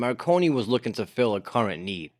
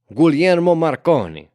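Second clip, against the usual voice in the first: loud, high, sing-song Italian accent; proudly exaggerated Italian accent; 5 semitones higher, 7 dB louder than usual. Shown as a demonstration. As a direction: roll the name out in hammed-up Italian. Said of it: "say it with the Italian accent".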